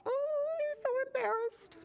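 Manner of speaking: high, cooing pity; sympathetic. Real, not acted. Reader to children about the blushing bird, cooing sympathy. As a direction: coo it high, drawing out the oh.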